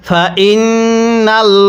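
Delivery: ফাইন্নাল্লাহা slowly, with the lam of আল্লাহ thick and full; heavy lam. Shown as a demonstration. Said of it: "আল্লাহ শব্দটিকে মোটা করে পড়ব".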